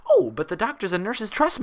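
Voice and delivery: high, chirpy bubbly pony; chirped. Acted. Pinkie reassuring Applejack about the nurses, chirpy. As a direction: chirp it brightly. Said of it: "Pinkie Pie chirped".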